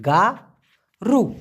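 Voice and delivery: গা and রু said as two separate, clear syllables; syllable-split demo. Shown as a demonstration. Teacher spelling the Malay word for learners, split syllables. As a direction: break গারু into গা then রু. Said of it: "উচ্চারণটা হবে".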